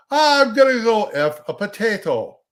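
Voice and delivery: high, loud mock Irish brogue; leprechaun-style Irish caricature. Shown as a demonstration. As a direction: ham up a cartoon Irish accent. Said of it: "he hates the stereotypical Irish accent".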